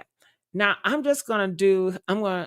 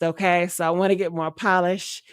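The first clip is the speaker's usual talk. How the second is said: normal talking voice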